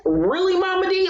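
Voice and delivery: high, incredulous call-out; can't believe she said that; shocked disbelief. Real, not acted. Reacting to Mama D's cruel clip, shocked disbelief.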